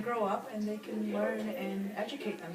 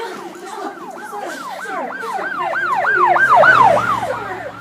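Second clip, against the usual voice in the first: high loud calls for attention; calling out high; 19 dB louder, much slower than usual. Acted. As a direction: call after someone in a crowd. Calling out in riot.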